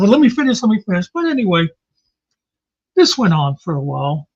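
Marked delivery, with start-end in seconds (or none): 0.0-1.1 mock insistent interruption. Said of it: "and they'd say".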